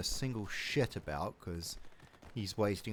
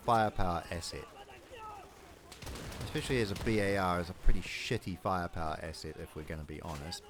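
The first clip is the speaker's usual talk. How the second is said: normal talking voice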